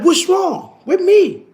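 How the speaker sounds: high, slow, put-upon "what's wrong with me"; mock bewildered woman. To the men, imitating a woman confused, mock bewildered. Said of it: "they be like".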